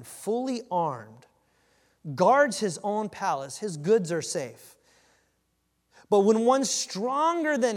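slow, loud preaching read; dramatic scripture reading. Acted. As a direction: build the parable word by word, slowly. Reads the parable aloud.

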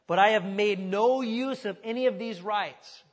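slow, loud, weighty reading of the verse; emphatic scripture reading. Acted. Preacher reading Paul's letter to the congregation, slow and loud. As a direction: read it out like scripture from the pulpit.

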